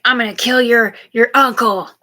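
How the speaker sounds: loud, heated mock threat rising at the repeat; retold threat. Voicing a stranger's threat heard through her mouth, loud and heated. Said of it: "he said".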